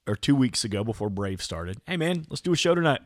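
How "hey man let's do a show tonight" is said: quick, higher-pitched pitch to a pal; eager text invite. Retold or imitated. Reading own text inviting a friend to record, eager. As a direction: toss out a brisk, upbeat invitation. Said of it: "my text to you last week was".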